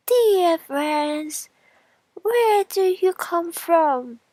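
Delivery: high, loud, slow little straw voice; high piping straw greeting; straw character. Acted. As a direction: squeak out a friendly greeting as the straw. Straw voiced in fairy tale.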